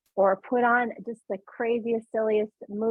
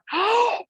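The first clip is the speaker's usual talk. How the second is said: sudden high gasp; sharp gasp; 12 semitones higher, 5 dB louder than usual. Shown as a demonstration. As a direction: gasp and stop laughing. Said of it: "put the bubble in your mouth".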